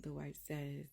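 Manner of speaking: normal talking voice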